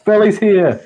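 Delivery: high, loud pretend cry for rescue; yelling for help. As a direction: holler out to a friend, panicked in play. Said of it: "I'm gonna yell out to".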